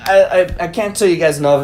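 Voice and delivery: normal talking voice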